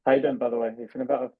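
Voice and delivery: ordinary talk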